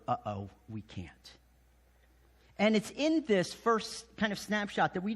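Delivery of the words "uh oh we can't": much softer and lower; a small, sheepish realization; hushed uh-oh. Retold or imitated. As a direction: soldiers finding they are powerless, hushed. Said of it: "they quickly discover".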